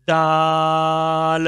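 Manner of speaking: दाल held very long and clear; very slow demo दाल; clear daal. Shown as a demonstration. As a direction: stretch out दाल slowly. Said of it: "बहुत easy".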